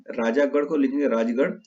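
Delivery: usual speaking voice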